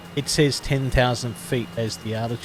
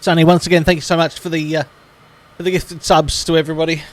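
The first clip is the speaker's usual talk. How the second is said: excited; 7 semitones higher, 8 dB louder, faster than usual. Real, not acted. Thanking a gifter excitedly.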